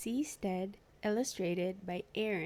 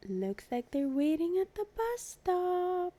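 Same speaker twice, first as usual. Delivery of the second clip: excited gasp; 9 semitones higher than usual. Real, not acted. Reader excited about picture.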